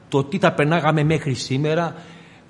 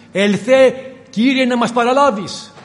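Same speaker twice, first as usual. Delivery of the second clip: loud, high cry of Ελθέ, Κύριε; a church crying out to heaven, loud and high; 7 semitones higher, 6 dB louder than usual. Acted. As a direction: call out to the Lord with full force. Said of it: "θα φωνάξει".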